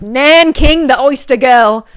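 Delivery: loud, high mock Cockney that slips into Australian; an accidental Australian accent on a Cockney attempt; Cockney accent turned Australian. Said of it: "I kept reading it in a Cockney accent".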